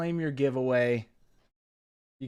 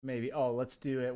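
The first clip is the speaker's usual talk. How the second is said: ordinary delivery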